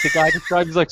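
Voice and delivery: normal talking voice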